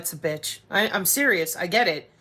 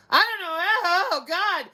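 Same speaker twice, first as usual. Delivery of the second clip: mocking doctor; 8 semitones higher, slower than usual. Mimics a doctor dismissing her.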